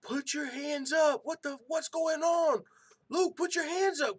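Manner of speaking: high, loud frantic yell at a fighter; reenacted shout of exasperated coaching from the sidelines; screaming. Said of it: "I was literally screaming".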